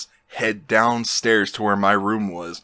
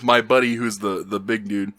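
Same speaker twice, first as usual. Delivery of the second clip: usual speaking voice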